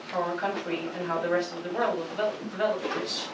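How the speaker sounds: normal talking voice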